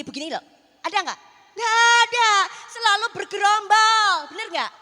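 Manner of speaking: loud, high, triumphant preaching; full-force preaching. Acted. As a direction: proclaim the miracle to the congregation. Bamboo sermon illustration. (khotbah berapi-api dengan suara keras)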